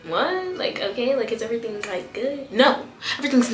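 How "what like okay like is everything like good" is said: very soft, tentative questions; hushed puzzled classmates. Retold or imitated. Whole class watching her switch seats, hushed puzzlement. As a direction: murmur it like a confused onlooker. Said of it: "the whole class is looking like".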